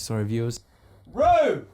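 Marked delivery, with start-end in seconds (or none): 1.1-1.6 loud, high shout across the room; yelling at Rex. Real, not acted. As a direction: bark it to get his attention. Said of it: "now you need to yell at Rex".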